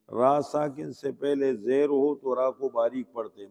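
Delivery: ordinary talk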